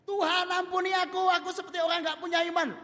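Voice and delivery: high, loud plea to God, a bit fast; loud repentant prayer. Acted. Girl begging God's forgiveness after crying for days, loud and high.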